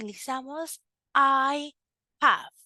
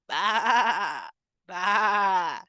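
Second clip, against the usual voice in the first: sheep bleat; 5 semitones lower than usual. Shown as a demonstration. Teacher imitates sheep.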